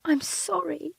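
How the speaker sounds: high, sobbing apology; crying. Acted. Wife apologising to furious husband, crying. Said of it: "I cry".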